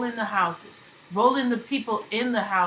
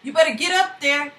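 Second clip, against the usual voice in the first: raised, urgent voice of a frightened man; urgent wake-up call; 5 semitones higher, 6 dB louder than usual. Acted. Man waking his friend in a flood, urgent and raised. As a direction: rouse him, the storm is here. Wakes friend during hurricane.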